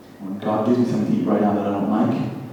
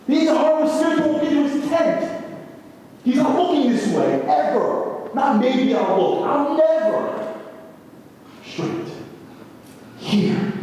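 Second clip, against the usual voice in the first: high-pitched, driving sermon at full force; impassioned preacher climbing in pitch; fiery preaching. Acted. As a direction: preach it up high, pushing harder and harder.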